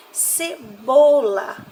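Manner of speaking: "cebola" slow, "bo" loud and stretched; stress on penultimate syllable. Shown as a demonstration. Teacher pointing out the tonic syllable, slow punched "bo". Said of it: "drim e bo".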